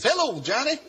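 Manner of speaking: high, sing-out phone greeting; cheery recognition. Retold or imitated. Preacher greeting the disguised caller, cheerfully unfazed. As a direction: imitate a preacher merrily unfooled by the caller.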